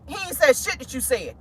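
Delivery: usual speaking voice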